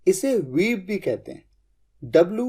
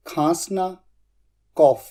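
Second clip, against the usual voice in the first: ordinary talk, nothing marked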